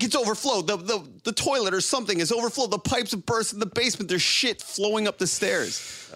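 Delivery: high-pitched mock panic, words tumbling out; imitated freak-out. Wife telling husband about burst pipes, imitated panic. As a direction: play the distraught wife, rushing then stretching the end. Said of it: "She's freaking out".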